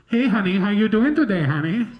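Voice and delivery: a hint of the honey-calling lady; lightly voiced cashier. Mimicking the drive-thru worker's sweet greeting, lightly voiced. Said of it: "she's got this, like, really distinct voice".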